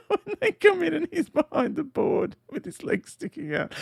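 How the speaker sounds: high-pitched, words through laughter; laughing. Real, not acted. Host recalls Evans behind the board, laughing. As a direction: describe it while cracking up. Amused by Evans's silly scene.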